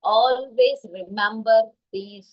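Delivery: slowly, as a lasting lesson; very slow emphasis. Shown as a demonstration. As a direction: spell out each word with long gaps. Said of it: "जो मैंने अभी कहा".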